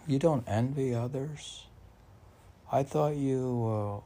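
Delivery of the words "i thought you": ordinary talk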